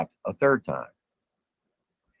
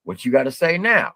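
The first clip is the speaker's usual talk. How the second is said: tough guy taunt; 6 semitones higher, 5 dB louder than usual. Retold or imitated. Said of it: "and said".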